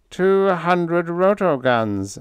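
high, very slow trance voice; loud, drawn-out psychic drone; droning. A narrator's reading. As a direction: intone it as if entranced. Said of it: "droned Thaxton".

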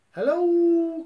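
very high, loud hello; calling out. Acted. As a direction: call into the cave. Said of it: "cried Pooh".

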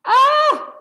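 high, loud frightened shriek; a sudden panicked cry; startled squawk. A narrator's reading. Turkey shrieking in fright as he bolts, high and loud.

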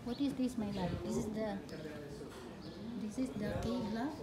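ordinary delivery

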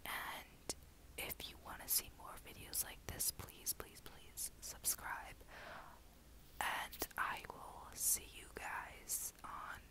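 late-night ASMR whisper, breathy and hushed. Real, not acted. Vlogger whispering to viewers late at night so others sleep. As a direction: whisper so no one wakes up. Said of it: "now I'm whispering".

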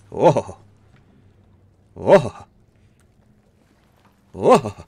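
loud startled exclamation. Real, not acted. Gamer startled by strange sounds in the cave. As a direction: blurt it out, caught off guard.

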